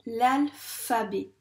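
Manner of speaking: "L'alphabet" slowly broken into its syllables; sounded out. Shown as a demonstration. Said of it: "taper les syllabes du mot alphabet". (« L'alphabet » articulé lentement, syllabe par syllabe)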